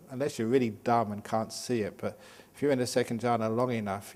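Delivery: normal talking voice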